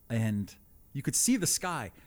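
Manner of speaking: ordinary delivery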